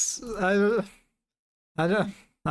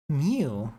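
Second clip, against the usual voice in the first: normal talking voice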